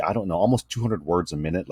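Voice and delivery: ordinary talk, nothing marked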